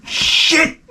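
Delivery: imitating mother's high-pitched swear; hearty motherly curse. Saintly mother stubbing her toe, high hearty curse. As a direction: let one swear burst out, very high. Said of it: "you get a hearty shit out of it".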